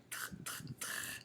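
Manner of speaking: "tr" breathed softly with no voice; whispered tr. Shown as a demonstration. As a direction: hiss out "tr". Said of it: "on entend le T".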